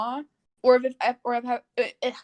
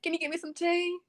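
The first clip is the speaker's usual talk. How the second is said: Southern mom; 6 semitones higher than usual. Acted. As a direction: wheedle in a put-on country twang. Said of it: "talk like a Southern mom".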